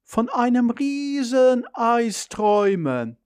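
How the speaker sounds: high, very drawn-out, dreamy mother; mother's recorded voice. A narrator's reading. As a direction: replay the sleepy mother's wish. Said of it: "mit Mutters Stimme". (Mutters Stimme, hoch und lang gezogen)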